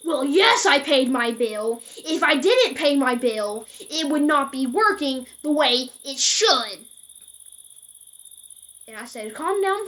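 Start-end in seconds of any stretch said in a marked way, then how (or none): 0.0-6.8 much louder and higher, slow, biting irritation; angry customer snapping down the phone; snapping angrily. Retold or imitated. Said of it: "And he snapped at this". 9.3-9.9 calm down. Retold or imitated. Worker scolding angry caller.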